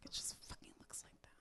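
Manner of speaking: fast hushed unvoiced hiss; annoyed whisper. Comic whispering back to the host, annoyed whisper. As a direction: snap it under your breath.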